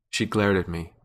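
ordinary delivery

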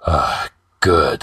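low satisfied grunt. A narrator's reading. Wounded king after drinking from the goblet, grunting. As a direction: grunt it low, falling back. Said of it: "he grunted".

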